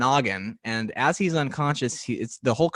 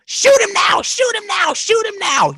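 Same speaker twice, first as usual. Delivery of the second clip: high, loud chanting shout; mock shouting crowd; 8 dB louder than usual. Animators in the meeting shouting their vote, high excited yells. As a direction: yell it like a rowdy room of animators.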